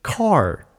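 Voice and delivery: "car" drawn out with a hard R; teacher's careful R on "car". Retold or imitated. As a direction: model "car" slowly, R fully curled. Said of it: "let's try saying car".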